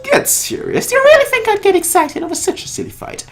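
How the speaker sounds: Joker. Acted. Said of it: "He will sound like that".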